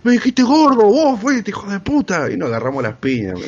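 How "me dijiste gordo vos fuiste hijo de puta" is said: much higher, louder mock rage; furious retort. Big kid furious at the narrator, high louder mock rage. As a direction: spit the insult back, hammed-up. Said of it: "se recalentó y me dijo".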